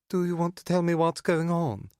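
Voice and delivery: high, mocking imitation of his voice; shrill clipped mockery. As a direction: parrot him back, shrill and sneering. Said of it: "imitates my voice with a shrill, clipped accent".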